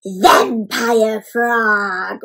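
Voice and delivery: very slow, drawn-out vampire voice; vampire frog. A narrator's reading. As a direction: stretch it out spookily. Announcing vampire costume in story.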